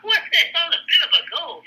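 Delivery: echoing the colonel's words with a laughing what the hell; incredulous laugh. Real, not acted. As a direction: laugh at the absurd understatement.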